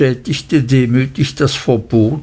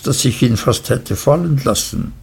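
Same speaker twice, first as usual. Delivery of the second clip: ordinary delivery, nothing marked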